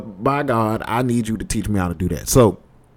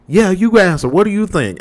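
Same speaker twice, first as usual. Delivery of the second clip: high mock female voice; demanding woman; 9 semitones higher, 3 dB louder than usual. Girlfriend dragging the roommate into the argument, high demanding mimicry. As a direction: imitate her pressing for an answer, pitched up.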